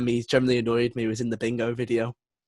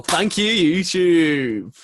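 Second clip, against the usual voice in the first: loud, higher, hammed-up thanks; playful louder thank-you to YouTube; mock gratitude; 6 semitones higher, 7 dB louder, much slower than usual. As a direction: call it out like a cheesy shout-out.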